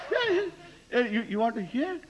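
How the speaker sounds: high voice, laughing through words. Real, not acted. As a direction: talk while laughing hard. Laughing through his mock offer.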